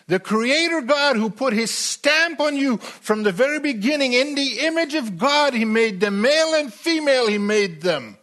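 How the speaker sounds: high, loud, driving preached climax; soaring revival-preacher delivery; preaching at full force. Acted. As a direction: build it like a pulpit crescendo.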